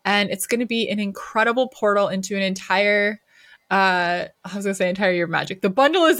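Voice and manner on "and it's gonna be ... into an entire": ordinary talk, nothing marked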